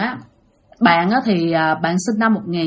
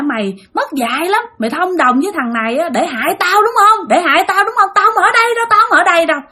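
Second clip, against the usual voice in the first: high, agitated accusation; furious cursing, acted; 8 semitones higher than usual. Possessed mother accusing friend of harming her, rising angry rant. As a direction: build from accusation into high-pitched refusal. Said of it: "chửi quá trời".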